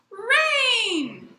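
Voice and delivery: high, loud, long joyful cry; jubilant young boy. Retold or imitated. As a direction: shout it out like a thrilled little boy. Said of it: "a jubilant boy, young boy".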